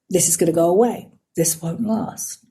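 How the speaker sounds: quiet, doubting inner voice; a hint of an inner critic; self-doubt voice. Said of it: "I call them weeds".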